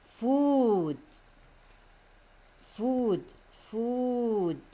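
food with a drawn-out oo; teacher modelling food with a long, held oo; long vowel sound. Shown as a demonstration. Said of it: "the word which is different is".

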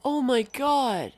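overwhelmed fan swooning; awestruck excitement. Real, not acted. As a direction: gush in high-pitched disbelief. Overwhelmed by the new Halo screenshots.

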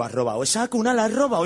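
high, loud mock alarm; shocked accusation. Comic to his brother about a cot, loud disbelief. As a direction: blurt out an appalled question.

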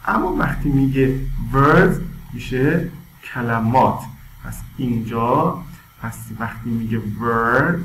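ordinary talk, nothing marked

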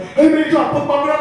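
high, loud accusing yell; mock yelling. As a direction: yell it across at a rival, comically. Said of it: "We kept yelling at each other".

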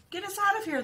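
high, pleading yell; group yell. A narrator's reading. Stuck family yelling for rescue together. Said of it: "they all yelled".

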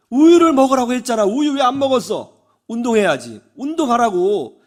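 loud, insistent scolding; nagging mother. Retold or imitated. Mother pours out nags at her son about milk and exercise, nagging. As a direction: re-enact a mother's exasperated nagging. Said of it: "잔소리를 막 쏟아내요".

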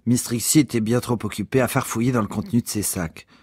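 ordinary delivery

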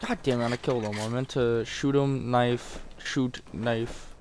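ordinary talk, nothing marked

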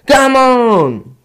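exasperated shout. Real, not acted. Gamer yelling at the game in exasperation. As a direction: yell it in exasperation.

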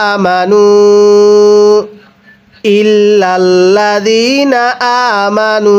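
slow melodic tilawat, drawn-out vowels; slow Quran recitation. Shown as a demonstration. Teacher recites the verse for students, slow melodic chant. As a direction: chant the verse slowly and evenly. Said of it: "তাহলে কিভাবে পড়ব?".

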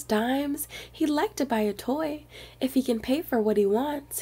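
plain reading voice

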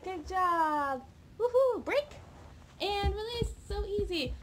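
high, loud "woohoo" praise; excited cheering. Real, not acted. Trainer celebrates puppy getting the new trick. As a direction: cheer the puppy on with real glee.

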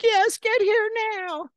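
very high, loud commanding mom; mom yelling orders. Retold or imitated. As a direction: mom ordering her son home at once. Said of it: "She's like".

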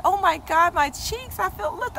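high, gushing over her cheeks; pure glee, voice way up; delighted amazement. Retold or imitated. Retelling her mirror moment, high delighted gush. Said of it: "I was like".

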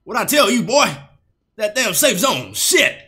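mock military announcer nagging the player; Graves announcer impression. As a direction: bark the order like the Graves announcer. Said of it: "full parrot mode".

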